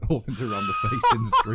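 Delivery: squealing high through laughter; can barely get it out for laughing. Real, not acted. To co-host, cracking up mid-joke, laughing.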